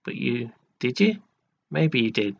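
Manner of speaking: ordinary delivery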